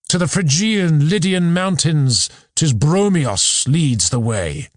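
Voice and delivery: poetry recitation. Acted. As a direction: declaim the verse with sustained, lifted pitch. Recites chorus of Maenads.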